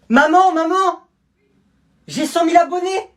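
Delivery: high, loud yell of pure joy; excited yelling. Acted. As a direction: shout the news to mum from the next room. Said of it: "Arrête de crier comme ça".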